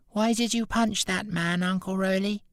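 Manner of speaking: put-on high, little boy asking; small child voice. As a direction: ask in a small, puzzled child's voice. Small boy questions his uncle.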